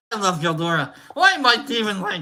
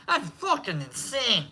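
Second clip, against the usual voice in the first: usual speaking voice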